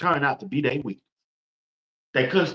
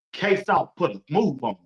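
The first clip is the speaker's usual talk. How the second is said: ordinary talk, nothing marked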